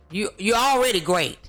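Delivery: ordinary delivery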